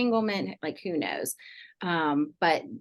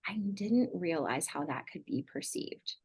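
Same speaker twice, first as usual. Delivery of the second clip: softer, slow and sincere; sincere apology; 5 dB quieter, slower than usual. Retold or imitated. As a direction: gently own it, no excuses. Parent sincerely owning the hurt.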